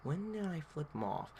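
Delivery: hushed, whispery thinking voice; inner thought. Retold or imitated. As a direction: whisper it as a thought in your head. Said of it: "In my head, I'm like".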